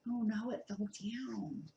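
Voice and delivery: hushed, breathless whisper. Acted. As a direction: whisper the bad news.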